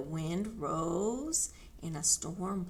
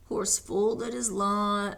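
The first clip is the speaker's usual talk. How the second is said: ordinary delivery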